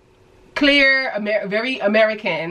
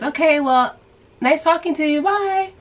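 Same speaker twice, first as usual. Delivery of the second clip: high, breezy nice talking to you, bye; cheery goodbye; 7 semitones higher than usual. Retold or imitated. Teen boy cheerily ends the chat, pitched high. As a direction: wrap it up brightly.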